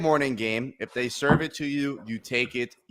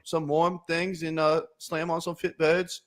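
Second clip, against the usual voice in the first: higher-pitched put-on English accent; 5 semitones higher than usual. Host gives his pick on request, in a put-on English accent. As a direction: give the betting pick as a mock Englishman. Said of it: "Give it to us in an English accent".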